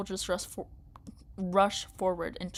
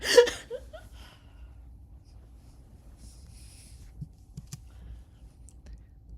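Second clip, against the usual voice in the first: villain cackling breathlessly; high whispery maniacal giggle. Acted. Gunner taunting the crew, high breathy giggle. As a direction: giggle like a madwoman.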